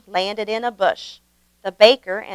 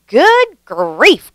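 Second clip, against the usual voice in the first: higher, louder exasperated shout; yelling; 5 semitones higher, 7 dB louder than usual. A narrator's reading. Grandpa Dan yelling as the pile keeps growing, loud. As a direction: burst out in exasperation. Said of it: "Grandpa Dan yelled".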